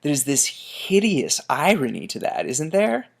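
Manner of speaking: ordinary delivery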